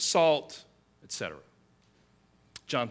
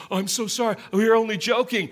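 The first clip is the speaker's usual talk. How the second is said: higher, louder put-on apology; mock apology; 6 semitones higher, 6 dB louder, faster than usual. Pranksters apologizing to their singed victim, mock-sorry and jokey. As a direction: play an insincere sorry, hammed up.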